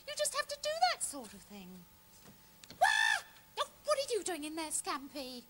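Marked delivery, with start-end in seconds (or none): 2.8-3.2 loud high shriek of surprise; sudden high "Wah!"; startled yelp. Acted. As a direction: cry out as if jumped on. Startled by Scampy in wardrobe.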